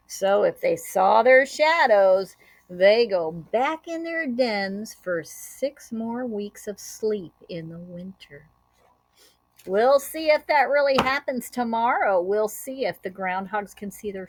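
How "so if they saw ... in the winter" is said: ordinary delivery, nothing marked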